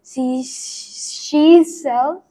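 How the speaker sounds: slow, high and loud repeat; slow careful repeat. Shown as a demonstration. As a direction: copy it carefully word by word. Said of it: "really fast".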